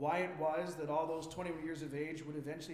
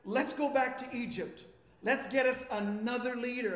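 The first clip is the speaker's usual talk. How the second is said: loud, higher-pitched mock protest; upset crowd; 4 semitones higher, 5 dB louder than usual. Israelites rejecting their leader, acted upset. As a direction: imitate a people up in arms. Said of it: "They got so upset".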